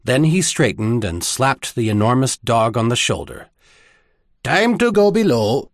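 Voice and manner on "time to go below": higher-pitched spoken order; spoken out loud. A narrator's reading. High Admiral sends his dog below, spoken out loud. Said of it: "he said out loud".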